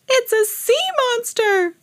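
high, slow frightened cry; shrieking alarm. A narrator's reading. Lady spots seaweed Harry, terrified shriek. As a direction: shriek the warning. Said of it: "she shrieked".

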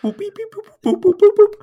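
high-pitched beeps of a dial pad; mimed phone dialing. Pretend. Miming dialing a demon on a phone.

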